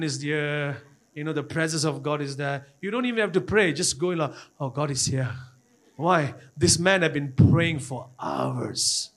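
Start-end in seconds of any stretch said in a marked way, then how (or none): none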